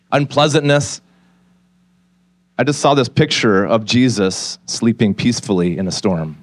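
normal talking voice

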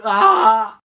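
short loud cry of pain; a sharp fake yelp of pain. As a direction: cry out as if hurt. Pained cry.

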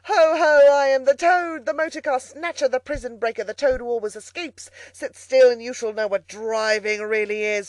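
loud, high, triumphant boasting; wild, swaggering Toad in full cry; reckless shout. A narrator's reading. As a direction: crow your own titles at full voice. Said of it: "he shouted recklessly".

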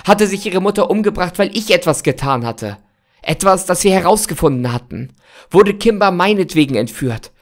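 desperate. Acted. Desperate, pleading over Kimber.

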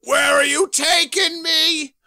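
high, loud hammed-up demand; villain shout. Villain shouts at the hero carrying him. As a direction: yell it at your captor.

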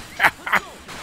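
high burst of laughter; short, high-pitched cackle of amusement; sudden laugh. Real, not acted. Laughs at the game.

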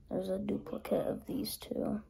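hushed aside while sorting erasers; whisper. Real, not acted. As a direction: whisper it to yourself.

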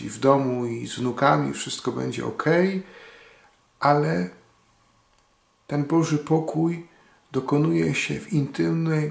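ordinary talk, nothing marked

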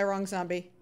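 ordinary talk

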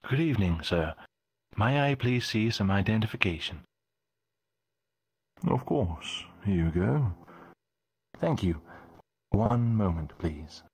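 lightly voiced formal guard; courteous checkpoint official; polite guard voice.

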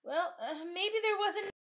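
high, faltering mother's voice; unsure, grasping for an excuse; helpless mom, high and halting. Acted. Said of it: "Mom says helplessly".

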